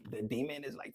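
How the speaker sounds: ordinary talk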